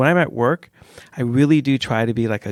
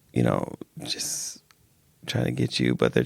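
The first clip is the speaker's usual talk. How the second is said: hushed, slow whisper; sneaky whisper; 13 semitones lower, 7 dB quieter than usual. Acted. Showing how passive aggressive people get you, sneaky whisper. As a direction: whisper it like a covert dig.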